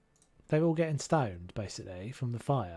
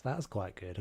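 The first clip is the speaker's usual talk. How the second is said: normal talking voice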